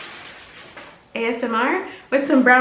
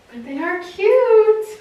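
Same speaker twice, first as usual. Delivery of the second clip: drawn-out put-on voice; 7 semitones higher, 3 dB louder, slower than usual. Real, not acted. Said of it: "know why I'm talking like that".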